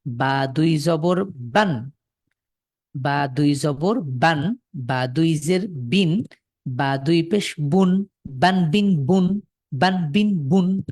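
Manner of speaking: slow, drawn-out বান, বিন, বুন with a clear n at the end; slow recitation drill. Shown as a demonstration. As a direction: model each syllable slowly for the class. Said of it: "তানভিন দিয়ে আমরা শেষে ন যুক্ত করব".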